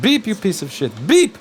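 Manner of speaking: put-on yelling in an Albanian accent, beep shrieked high; angry Albanian yell at the machine. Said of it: "yell at it with his Albanian accent".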